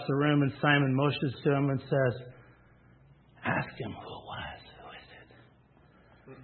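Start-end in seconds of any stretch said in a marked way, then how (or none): 3.4-6.4 hushed secret question; whisper. Acted. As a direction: whisper it across the table. Peter motions secretly across the table.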